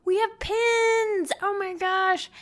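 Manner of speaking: high, loud, slow, thrilled; excited announcement. Real, not acted. Host telling listeners about new pins, high and thrilled. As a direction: announce it like a gift reveal.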